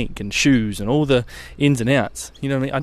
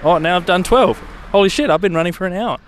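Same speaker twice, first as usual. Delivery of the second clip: amazed; 6 semitones higher than usual. Retold or imitated. Runner's amazed inner voice.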